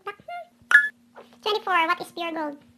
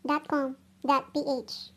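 ordinary talk

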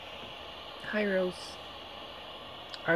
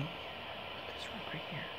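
whispering; 13 dB quieter, slower than usual. Real, not acted. Whispered instruction during session.

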